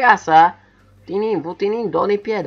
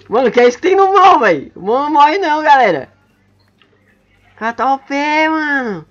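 high, astonished outburst; amazed exclaim; 7 semitones higher, 5 dB louder than usual. Real, not acted. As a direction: sound truly amazed. Amazed at strong opponent.

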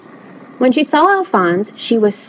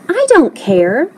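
slow, higher-pitched protest; defiant cry; 6 semitones higher, slower than usual. A narrator's reading. Lewis defends his friend, crying out. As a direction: cry out with drawn-out words. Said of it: "cried Lewis".

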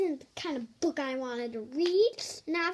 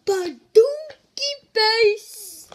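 loud, slow, drawn out silly yell; goofy yell; 5 semitones higher, 11 dB louder, much slower than usual. Acted. Reader at the climax of the silly sounds, drawn-out yell. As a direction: stretch it out big and goofy.

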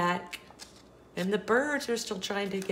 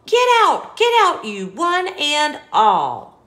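high, loud shooing yell; angry shout; 8 semitones higher, 12 dB louder than usual. Acted. Angry bear chasing the animals off, yelling. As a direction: chase them away with a shout.

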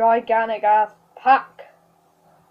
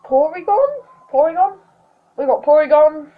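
excited; 4 semitones higher, 5 dB louder than usual. Real, not acted. Excited at pulling Porygon.

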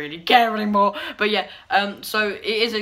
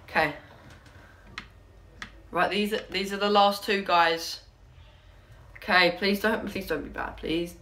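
ordinary talk

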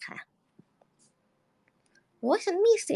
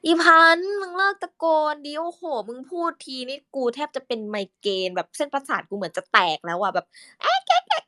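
voice for Phan; 8 semitones higher than usual. Shown as a demonstration. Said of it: "คุยกับพี่พันธุ์ใช่ไหมคะ".

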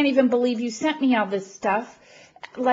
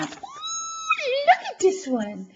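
slow, very high squeal; delighted squeal; slower than usual. Real, not acted. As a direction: squeal it out with glee. Showing off another delightful gift.

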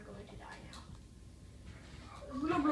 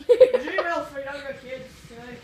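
laughing tease; 12 dB louder, slower than usual. Real, not acted. Teasing his teammate about Gmail, laughing. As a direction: giggle it out as a tease.

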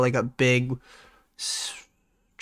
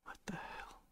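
whisper; 29 dB quieter than usual. Real, not acted. Quiet startled aside.

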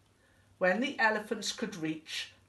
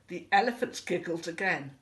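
ordinary delivery

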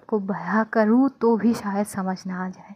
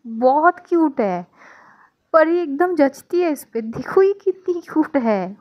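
higher, louder delighted gushing; 5 semitones higher, 4 dB louder than usual. Acted. Mother gushes about the baby while tearing up. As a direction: gush about her through happy tears.

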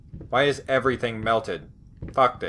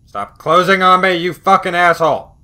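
loud, furious yell at a door; real frustrated shouting at a closing door; angry yelling; 5 semitones higher, 8 dB louder than usual. Real, not acted. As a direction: bark it at the stubborn door. Door keeps closing on him.

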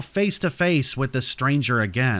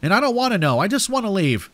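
high, desperate outburst; yelling; 9 semitones higher, faster than usual. A narrator's reading. Narrator desperate to leave the stranger, yelling. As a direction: yell it high, pleading to get away. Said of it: "I yelled".

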